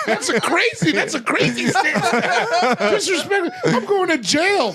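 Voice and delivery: high, laughing shouted rant; wild excited rant. Retold or imitated. Arrestee shouting gleefully at Seagal, high and laughing. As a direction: yell it like the best arrest of your life.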